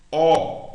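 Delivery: a loud, wide-open O sound. Shown as a demonstration. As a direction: say O very open, firmly. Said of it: "bem aberto".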